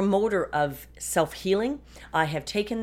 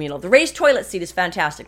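dry mock enthusiasm, louder; sarcastic; 7 dB louder than usual. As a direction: oversell it, clearly not meaning it. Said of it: "I say with great sarcasm".

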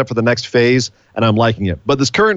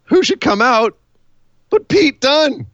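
excited reveal; 11 semitones higher, slower than usual. Real, not acted. Building up a surprise entrance.

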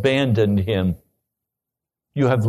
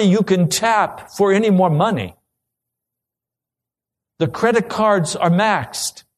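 higher, louder impassioned preaching; pleading sermon climax; 7 semitones higher, 4 dB louder than usual. Acted. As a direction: press the congregation with building urgency. Preaching at rising force.

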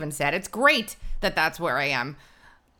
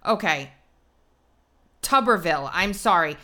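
ordinary talk

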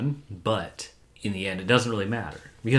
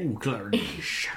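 higher, put-on eerie greeting; creepy movie voice; 4 semitones higher than usual. Co-host echoing the movie line, creepy put-on voice. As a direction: draw out a creepy mock radio greeting. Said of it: "the voice came over a radio".

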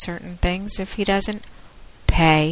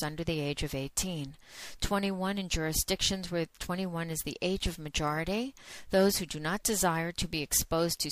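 softer, even legal disclaimer read; 2 semitones higher, 12 dB quieter than usual. Acted. Host warns listeners before the show, in a soft formal disclaimer read. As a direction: read the warning through in a steady, formal voice. Reads the adult-content disclaimer.

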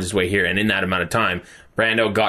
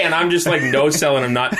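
ordinary talk

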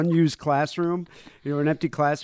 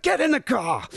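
urgent yell; 10 semitones higher, 4 dB louder than usual. Retold or imitated. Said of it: "my dad'd be like".

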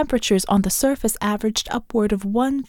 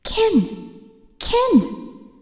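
much higher, urgent calling voice; eerie calling voice; 13 semitones higher than usual. A narrator's reading. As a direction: cry out from inside her head. Alien voice calling in her head.

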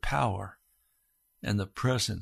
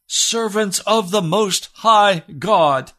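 loud, higher royal summons; shouting king; 7 semitones higher, 7 dB louder, slower than usual. Acted. King shouting into the blazing furnace. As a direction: call them out of the furnace with full force. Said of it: "he shouts".

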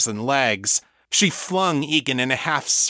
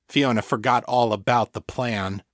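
usual speaking voice

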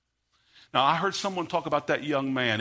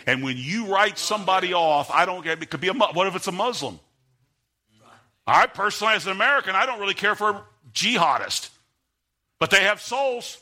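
preaching, climbing higher and louder; rising preaching; 6 semitones higher, 5 dB louder than usual. Acted. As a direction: build the sermon up toward full force. Preacher builds to full force.

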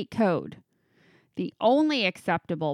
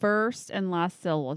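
ordinary delivery

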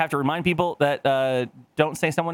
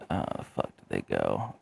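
muttering while searching; 9 dB quieter, slower than usual. Real, not acted. Searching for files.

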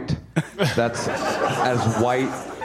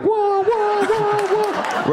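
squealing whoa; much slower than usual. Pretend. Traction control light of their clitoris, squealing whoa. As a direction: shriek each whoa way up high.

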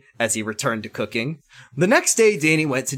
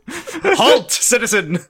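high, loud hammed-up officer call; mock loud command; 10 semitones higher, 7 dB louder, slower than usual. A narrator's reading. Chris halts Danny, mock loud command. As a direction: call it out like a cartoon lawman. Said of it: "said Chris in a loud voice".

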